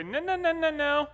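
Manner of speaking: high-pitched run of quick no's; mock dad correction. Dad catching his sons' misquote, high-pitched correcting. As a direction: play a dad stopping the boys mid-mistake, voice way up.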